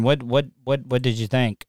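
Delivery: ordinary talk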